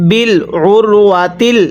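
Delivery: normal talking voice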